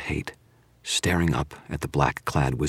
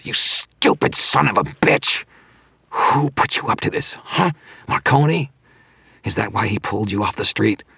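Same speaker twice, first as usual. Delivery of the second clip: high, strained angry demand; furious; 6 semitones higher, 4 dB louder than usual. A narrator's reading. Villain demanding who betrayed him, furious. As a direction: rage at him in a raised, strained voice.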